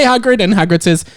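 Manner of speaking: normal talking voice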